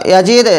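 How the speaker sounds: ইয়াজিদ with long i and bounced d; qalqalah demo. Shown as a demonstration. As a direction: release the final d of ইয়াজিদ in a short echo. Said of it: "এরকম ধরনের".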